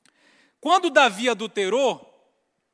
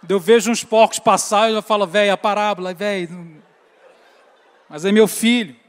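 laughing; 9 dB louder than usual. Real, not acted. Laughing at his own joke.

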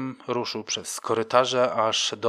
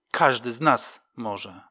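ordinary delivery

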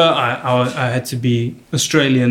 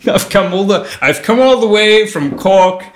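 higher, put-on Irish speechmaking; Irish accent toast; 6 semitones higher, 3 dB louder than usual. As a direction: give a party toast in a fake Irish accent. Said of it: "I even made a toast".

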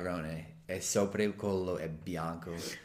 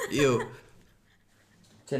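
usual speaking voice

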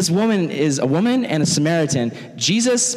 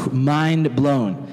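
normal talking voice

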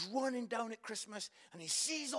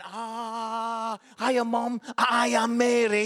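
loud, slow, drawn-out school-play recital; little Mary in a play; 11 dB louder, much slower than usual. Retold or imitated. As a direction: mimic a child announcing her line loudly. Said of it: "little Mary with a cushion up her jumper going".

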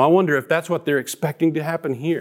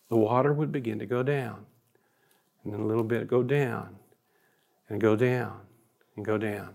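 sinking softer with each phrase; gradually fading; 2 semitones lower, 6 dB quieter than usual. Real, not acted. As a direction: let the voice drop away, pausing between phrases. Water slowly receding, step by step.

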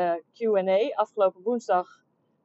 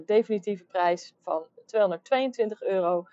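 usual speaking voice

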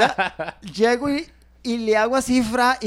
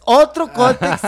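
louder, higher urgent call; imitated call; 5 semitones higher, 9 dB louder, slower than usual. Asking grandma for another pad, a loud call. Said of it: "y la abuela".

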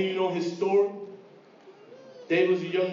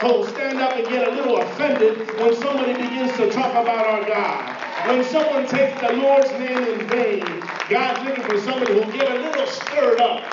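rising, louder preacher's call, higher; full-force preaching; 4 semitones higher, 4 dB louder than usual. Acted. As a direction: stir the congregation up.

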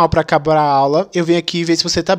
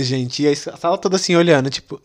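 usual speaking voice

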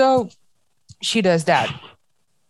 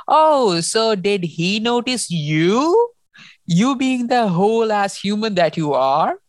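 sarcastic comeback; 3 semitones higher than usual. Retold or imitated. Cinder fires back at Pearl, sarcastic, acted. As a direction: stretch it out with exaggerated sarcasm.

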